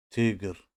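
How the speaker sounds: تیگر said as Russian "tigr", a short loud syllable; تیگر as a teacher's emphatic example; Russian word. Shown as a demonstration. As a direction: model تیگر sharply, falling at the end. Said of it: "مثل".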